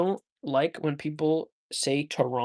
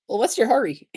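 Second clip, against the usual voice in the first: higher mock Russian voice; mock Russian accent; 7 semitones higher, 4 dB louder, faster than usual. Shown as a demonstration. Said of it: "Are you, are you Russian?".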